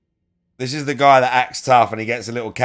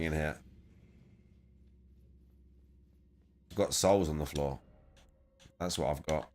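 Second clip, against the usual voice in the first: quiet muttering; 5 semitones lower, 9 dB quieter than usual. Real, not acted. Muttering to himself off-mic.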